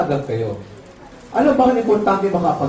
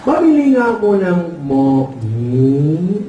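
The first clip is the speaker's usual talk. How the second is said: ngongo customer; 2 semitones lower, much slower than usual. Acted. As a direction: imitate a customer with garbled consonants, drawn out. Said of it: "ngongo din. Sabi ng ngongo".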